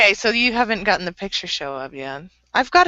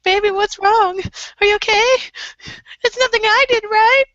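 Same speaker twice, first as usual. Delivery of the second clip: high-pitched, imitated anxious pleading; anxious fretting; 11 semitones higher, 3 dB louder than usual. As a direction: fret over your partner, voice way up. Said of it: "because I have so much anxiety".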